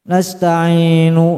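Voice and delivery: slow chanted "Nasta'inu" with nu sounded; audible final u. Shown as a demonstration. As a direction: sing "Nasta'inu" and let the last u be heard. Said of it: "jangan kedengeran ntar".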